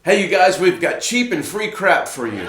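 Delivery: hammed-up promo read; scripted promo read. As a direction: pitch it up like a promo announcer.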